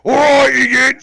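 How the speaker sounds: high, drawn-out orc bellow. Acted. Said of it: "doing the orc voice for the last time".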